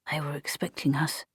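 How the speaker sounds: quiet grim mutter. Acted. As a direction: mutter it to yourself. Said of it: "muttered Shufti".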